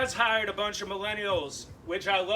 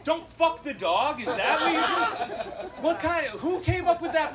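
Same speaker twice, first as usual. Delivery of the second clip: loud disbelieving rant; incredulous outburst; 8 semitones higher, 5 dB louder than usual. Real, not acted. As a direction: shout it in disbelief. Outraged at the phrase.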